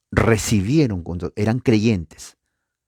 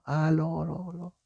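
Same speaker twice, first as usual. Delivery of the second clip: fading echo; 7 semitones higher, 8 dB quieter than usual. Shown as a demonstration. Preacher imitates the valley echo returning, fading to a whisper. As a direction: echo dying away to a whisper. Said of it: "nuestra propia voz regresaba".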